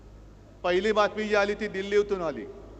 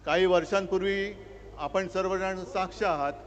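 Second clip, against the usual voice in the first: rally speech; 2 semitones lower than usual. Acted. Opens rally address to crowd.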